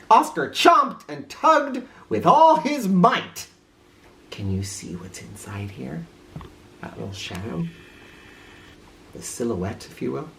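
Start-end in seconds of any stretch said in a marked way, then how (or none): 4.3-10.3 much softer, pointing out the shadow; hushed aside. Acted. Reader showing children the picture, hushed. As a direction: draw listeners in quietly. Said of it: "aside pointing to picture".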